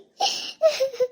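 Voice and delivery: high falling sob; a sob that climbs high then drops away. Acted. Grieving aunt remembering her dead sister, a high falling sob.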